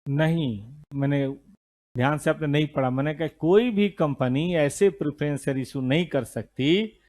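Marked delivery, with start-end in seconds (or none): none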